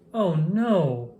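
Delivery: ordinary delivery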